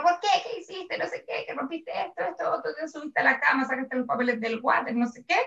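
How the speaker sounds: high-pitched scolding, kept soft; half-voiced mock tirade, starting high; mock yelling rant. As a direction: imitate an owner telling off the dog. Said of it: "empiezo a gritarle a mi perro".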